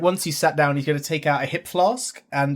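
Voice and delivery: ordinary delivery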